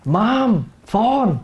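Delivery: loud call across the house, Mom then phone; shouting for mom. Retold or imitated. Child calls mom to the phone, blunt loud call. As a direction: yell it out bluntly to the next room. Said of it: "sometimes we scream".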